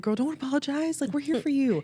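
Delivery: high, bright mock reassurance; reassuring friend. Imitating her friend telling her not to apologize, high and reassuring. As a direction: play her friend brushing it off kindly. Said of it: "And you were like".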